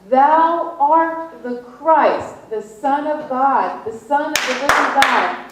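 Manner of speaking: loud, slow, high declaration; proclaimed confession. Retold or imitated. Peter confessing Jesus as Christ, loud and slow. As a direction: declare it boldly, full voice.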